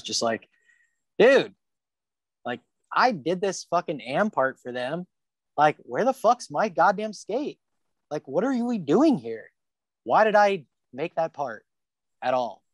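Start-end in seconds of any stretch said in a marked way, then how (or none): 1.2-12.6 retold angry rant. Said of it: "I was pissed".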